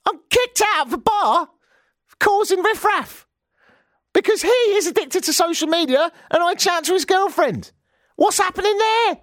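very high, incredulous protest; indignant outrage. Retold or imitated. Host telling listeners he was kicked out of a bar, indignant outrage. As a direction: squeal the injustice of it. Outraged retelling of being thrown out.

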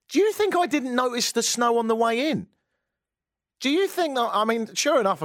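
lightly voiced indignant president; mock president. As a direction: play the offended president. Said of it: "I'm speaking as the president".

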